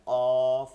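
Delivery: "কফ" loud and drawn out, with a knock on the first sound; heavy qaf. Shown as a demonstration. Teacher demonstrates the letter to students, heavy qaf. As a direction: hit "কফ" hard from the back of the tongue. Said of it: "একটু বাড়ি দেওয়া যেন বলা হয়".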